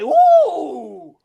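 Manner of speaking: long, loud, high ooh; mock swooning. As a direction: imitate a man wowed by a passing girl. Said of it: "you say".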